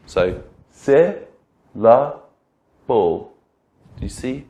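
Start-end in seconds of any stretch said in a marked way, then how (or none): 0.7-3.3 "se, la, bo" with a clear pause after each syllable; slow, split syllables. Shown as a demonstration. Teacher counting chin drops on the hand, slow syllable-by-syllable demo. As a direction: say "se", "la", "bo" as three separate beats, falling on the last. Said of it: "Say it slowly and pause for each syllable".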